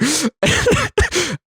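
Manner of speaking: high-pitched burst of laughter. Real, not acted. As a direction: laugh at the irony. Laughing at own story.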